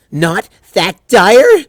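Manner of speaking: high, slow, disbelieving pony cry; frantic Twilight. Acted. Twilight panicking to her friends, high and loud. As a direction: throw her words back in horror.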